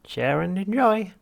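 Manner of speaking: high, slow, loud cheery machine voice; chirpy machine. Acted. Drink machine chirping its motto at Arthur, cheery machine voice. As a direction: sing it out like a relentlessly cheerful vending machine. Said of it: "the machine repeated".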